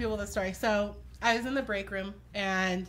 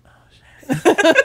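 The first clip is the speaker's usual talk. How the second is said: loud, high, laughing startled curse; laughing oh shit; 9 semitones higher, 17 dB louder than usual. Retold or imitated. Coworker startled by the ghost's voice, laughing loudly. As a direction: blurt it out through laughter.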